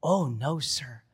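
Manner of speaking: put-on soft, hushed little girl's reply; tender and softened, nearly whispered toward the end; sweet, tender voice. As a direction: imitate a grateful child gently. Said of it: "this sweet, tender voice".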